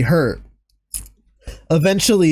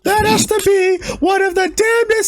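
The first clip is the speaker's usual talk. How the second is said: laughing high; 13 semitones higher than usual. Real, not acted. Laughing after the bit.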